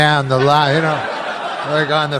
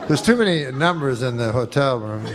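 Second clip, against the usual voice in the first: ordinary talk, nothing marked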